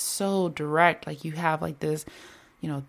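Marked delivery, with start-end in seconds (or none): none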